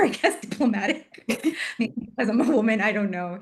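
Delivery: laughing. Real, not acted.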